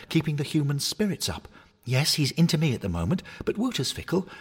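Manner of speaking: rally your friends, brightly; encouraging toy hornet. Acted. Harry consoles the bears.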